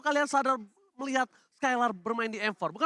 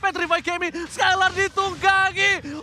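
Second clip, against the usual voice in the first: louder, higher shouting hype; fighting game announcer; 4 semitones higher, 3 dB louder than usual. Real, not acted. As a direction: scream the play like a caster. Caster hype on team fight.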